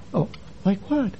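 soft surprised reply; quiet reply. Retold or imitated. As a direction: drop to a near hush. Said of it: "I thought I was whispering".

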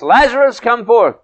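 high, loud shouted command; shouting out a summons. Retold or imitated. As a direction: call it out loudly, high-pitched, as if summoning someone. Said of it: "he shouts".

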